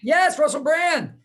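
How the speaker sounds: delighted it's found at last; relieved recognition. Real, not acted. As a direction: burst out as the name finally lands. Finally recalls the forgotten name.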